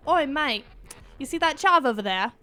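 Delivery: put-on casual English accent, slightly lifted pitch; British street accent. Correcting friends on what Brits say, British accent. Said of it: "what they'll usually say is".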